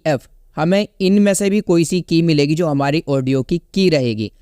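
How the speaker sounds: usual speaking voice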